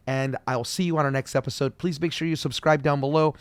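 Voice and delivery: normal talking voice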